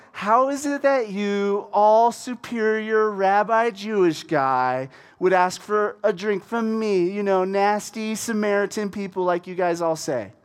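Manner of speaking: drawn-out, mock-offended sarcasm; sarcastic. Samaritan woman dishing it back to Jesus, sarcastic. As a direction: dish it out slowly, dripping sarcasm. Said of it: "Can you hear the sarcasm? Can you hear the, the, the intonation?".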